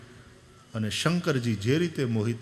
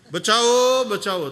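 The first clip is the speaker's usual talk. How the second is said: high, loud cry for help; shouting for help; 8 semitones higher, 7 dB louder, slower than usual. Acted. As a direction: yell for rescue. Grandfather cries help in well. (મદદ માટે જોરથી બૂમ)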